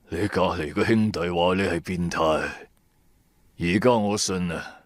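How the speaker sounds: put-on low grim old man. A narrator's reading. Old man's grim reply.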